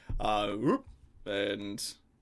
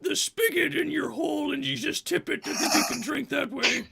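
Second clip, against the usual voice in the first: high, loud, laughing instruction; troll barmaid voice; 6 dB louder than usual. Acted. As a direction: explain it bluntly, half laughing. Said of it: "it's just like".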